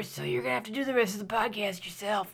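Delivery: whispery lost voice. Pretend. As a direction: croak it out with no voice left. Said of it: "I don't have a voice anymore".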